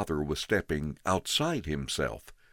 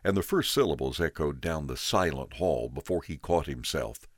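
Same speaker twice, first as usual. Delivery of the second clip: usual speaking voice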